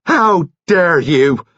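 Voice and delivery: high, loud, drawn-out outrage; outraged cry. A narrator's reading. Nurse springs up at the accusation, outraged cry. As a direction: cry out slowly, pitch soaring. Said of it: "Nurse Kettle cried out".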